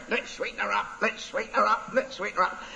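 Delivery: high, quiet parrot murmur; a parrot chanting under its breath; soft parrot voice. Acted. Parrot repeating softly.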